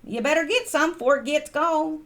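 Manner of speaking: put-on a touch of Wilkes County Southern accent; Southern folksy saying. Vlogger imitating Wilkes County locals, light Southern accent. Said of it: "people talked like that".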